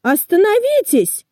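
high-pitched calling out, little added volume; storybook shout, held back in volume; mild acted shout. A narrator's reading. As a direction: call after a departing train, pitch well up. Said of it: "кричали они".

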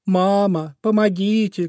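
high pleading call; crying for help. Acted. Trapped bunny calls for help, high and pleading. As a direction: beg for help through tears. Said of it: "кричал он".

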